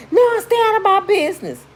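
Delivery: high, loud defensive snap; sharp high retort; defiant niece. Retold or imitated. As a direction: brush her off, defiant. Niece snapping back while being beaten.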